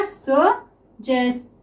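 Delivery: stretched very slowly while writing; drawn-out word. Shown as a demonstration. Teacher writing shorthand for students, word stretched slowly. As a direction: draw the word out as you write it. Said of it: "teacher sounds the word out while writing".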